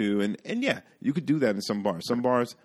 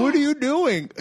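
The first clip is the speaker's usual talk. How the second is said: high, loud, exasperated mock yell; retold outburst; 6 dB louder than usual. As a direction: cry out in disbelief, pitch shooting up. Retold outburst at pushy customer.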